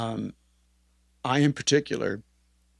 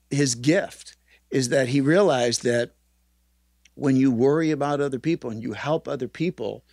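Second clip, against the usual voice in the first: ordinary talk, nothing marked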